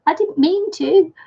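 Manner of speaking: giggling through the words; laughing helplessly, apologetic; laughing apology. Real, not acted. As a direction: say sorry while still laughing. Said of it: "Laughing so hard".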